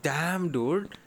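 normal talking voice

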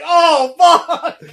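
high, loud mock wail with laughter; retold shocked outburst. Viewer retelling their own shock, retold outburst. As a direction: re-enact your shock at full volume, laughing. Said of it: "I was like".

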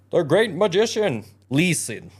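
high, loud proclamation, then a firm order; commanding tomcat. A narrator's reading. Tomcat orders the haymakers to listen, grand command.

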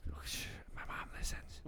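soft, low whisper; hushed realisation. Real, not acted. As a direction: whisper it as if caught out. Realises his mom is listening.

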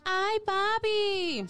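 "Ay, papi" agudo y muy lento, sensual; mujer de reggaetón.

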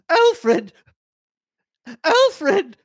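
high strangled cry. Acted. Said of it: "cried out in a strangled voice".

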